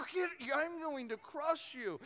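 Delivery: high, slow exasperated threat; hammed-up parental fury, drawn out; mock threat. Retold threat to the kid.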